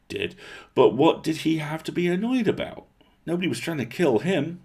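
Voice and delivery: ordinary delivery, nothing marked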